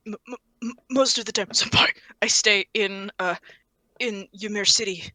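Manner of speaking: soft, stammering shy mumble; nervous mumble. Acted. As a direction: shrink away, barely audible. Kevin nervous, shrinking.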